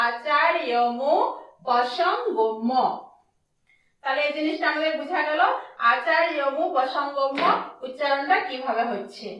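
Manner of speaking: normal talking voice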